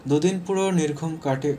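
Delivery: plain reading voice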